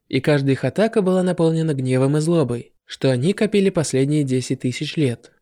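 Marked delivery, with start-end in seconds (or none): none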